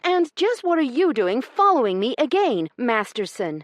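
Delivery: high, loud, furious lady's counterattack. Acted. Io rounding on Corbin for following her, furious. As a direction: turn his words back on him.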